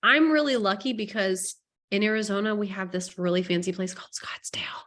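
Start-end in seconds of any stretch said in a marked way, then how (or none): 2.5-4.8 hushed whisper, as if sharing a secret; confiding whisper; whispered aside. Real, not acted. Aside about fancy Scottsdale.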